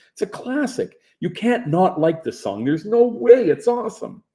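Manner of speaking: high, loud, exasperated insistence; arguing hard for a favourite song; heated defense. Retold or imitated. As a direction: defend the song like it's personal. Heated argument about a song.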